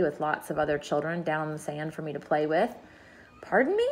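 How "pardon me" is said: high, gentle murmur; timid high-pitched query. A narrator's reading. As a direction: ask it politely in a high, small voice. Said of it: "murmured the ladybug".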